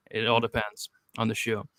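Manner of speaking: ordinary talk, nothing marked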